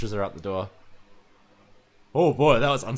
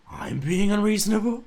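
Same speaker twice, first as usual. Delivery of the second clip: incredulous; 7 semitones higher than usual. Acted. Yuri repeats accusation in disbelief.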